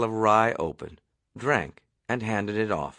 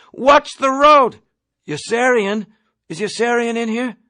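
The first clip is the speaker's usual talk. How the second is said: panicked yell; 11 semitones higher, 7 dB louder than usual. Acted. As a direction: scream it as the jeep swerves. Panicked passenger yelling at driver.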